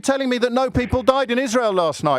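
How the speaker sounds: high-pitched, put-on indignation; mock outrage. Host throwing caller's complaint back at him, mock outrage. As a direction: feign being scandalised.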